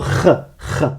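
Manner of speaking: "ch" as a throaty scraped fricative; isolated kh sound. Shown as a demonstration. Teacher isolates the sound for learners, "ch" as a rasped Arabic kh. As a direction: rasp "ch" at the back of the throat. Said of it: "Le son ch".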